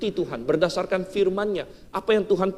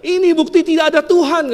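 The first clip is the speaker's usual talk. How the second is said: high, loud triumphant taunt; gloating shout; 10 semitones higher, 6 dB louder than usual. Retold or imitated. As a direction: jump out and crow at her. (teriakan ejekan yang keras dan tinggi)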